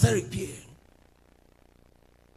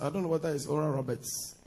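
usual speaking voice

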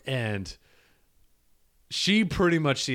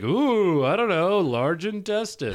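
clueless masseur; 7 semitones higher, 4 dB louder, slower than usual. Pretend. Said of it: "be like".

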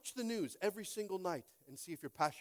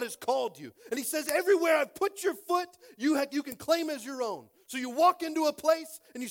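much louder and higher preacher voice; full-force preaching; 10 semitones higher, 11 dB louder than usual. Acted. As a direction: drive it home to the congregation.

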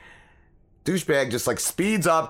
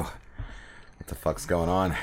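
quiet drawl; 7 semitones lower, 6 dB quieter, much slower than usual. Real, not acted. Host's slow hushed opener.

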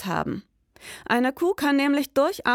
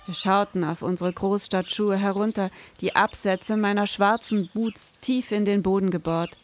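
low, measured crime-novel voiceover; brooding storyteller reading; noir story narration; 3 semitones lower than usual. A narrator's reading. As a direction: set the scene like a detective story.

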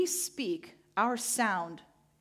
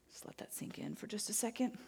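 whisper; 11 dB quieter than usual. Real, not acted. Preacher pauses for reflection.

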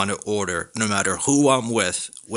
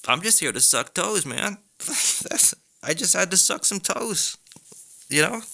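light, high mock-casual intruder; a high, breezy hint of a toe-sucking burglar; 10 semitones higher than usual. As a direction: toss it off like it's no big deal. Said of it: "The dude's like".

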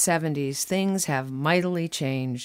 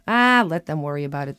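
usual speaking voice

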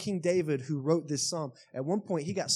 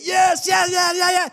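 much higher and louder, ecstatic yell; reenacted goal scream; 14 semitones higher, 10 dB louder than usual. Soccer fan reenacting his goal celebration, screaming yes. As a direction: shout it like the winning goal just went in. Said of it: "full out sprint".

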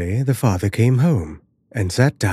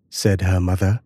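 plain reading voice

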